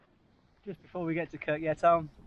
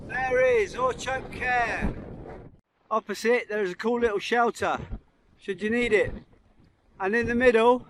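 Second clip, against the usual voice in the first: raised voice against the wind; shouting over wind; 6 semitones higher than usual. Real, not acted. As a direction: call out over the gale. Strong wind on the summit.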